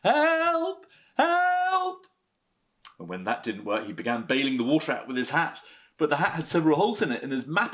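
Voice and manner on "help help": very high, louder cries; stuck bear calling very loudly. Acted. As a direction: yell for help from the bath. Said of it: "then very loudly".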